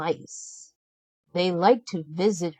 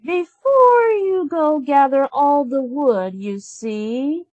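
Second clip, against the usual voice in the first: higher, louder, slow ordering voice; bossy stepsister; 8 semitones higher, 4 dB louder, slower than usual. A narrator's reading. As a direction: give orders slowly and grandly. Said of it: "Drizella said".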